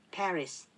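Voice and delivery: Paris said the English way, ending in a clear s; English pronunciation. Shown as a demonstration. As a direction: contrast Paris against katakana パリ. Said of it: "パリはParis". (英語の発音でParis)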